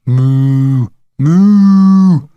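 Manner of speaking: put-on boy's insistent mooing shout; cried out. A narrator's reading. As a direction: bellow moos at a cow, rising. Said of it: "cried Jeremy James".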